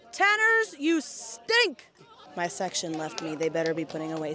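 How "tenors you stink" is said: calling out. Real, not acted. Calling out to her section.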